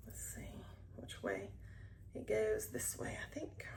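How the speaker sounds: breathy unvoiced whisper, mostly toneless; whispering to herself. Real, not acted. Vlogger checks which way her project goes, whispering. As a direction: murmur it under your breath as you check.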